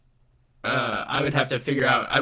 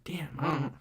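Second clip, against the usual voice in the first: whispering; 2 semitones higher, 9 dB quieter than usual. Real, not acted. Muttering over stream audio trouble.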